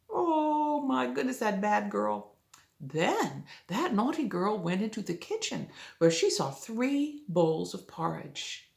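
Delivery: usual speaking voice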